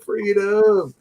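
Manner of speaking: high, drawn-out hammed-up cheer; mock triumphant cry. Host acting out landing in Texas, mock triumphant cry. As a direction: pretend to rejoice on finally arriving.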